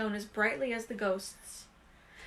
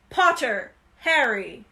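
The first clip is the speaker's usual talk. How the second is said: louder, called across the hall; calling out; 8 dB louder, slower than usual. A narrator's reading. McGonagall calling Potter to the stool, loud. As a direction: announce the name to the hall.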